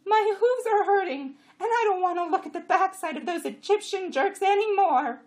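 high, very loud donkey complaint; braying jenny. A narrator's reading. Jenny protesting to her rider, braying high. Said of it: "Chamra brayed".

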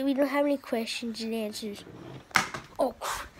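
ordinary talk

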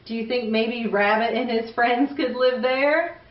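usual speaking voice

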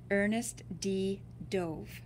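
ordinary delivery

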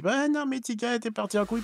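high, quick, soft mock complaint; whiny imitation of a viewer. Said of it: "qu'après vous me dites".

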